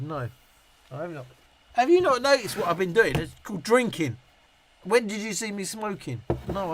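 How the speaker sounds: ordinary talk, nothing marked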